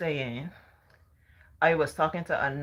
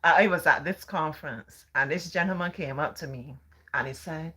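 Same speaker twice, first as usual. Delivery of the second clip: ordinary talk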